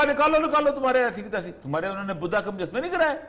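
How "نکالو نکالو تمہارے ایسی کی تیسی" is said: a raised, mock-bossy demand for cash; mock bossy order. America ordering Japan to pay up, loud mock command. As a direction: bark it at Japan like a pushy boss, louder.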